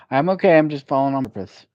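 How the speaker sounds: loud and high, brushing it off; loud cheerful reply. Retold or imitated. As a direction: call it out brightly to reassure. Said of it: "And so sometimes I say".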